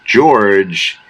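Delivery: normal talking voice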